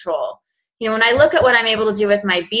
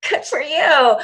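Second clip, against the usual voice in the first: sarcastic; 6 semitones higher than usual. Retold or imitated. Sarcastic reply to coworkers' news.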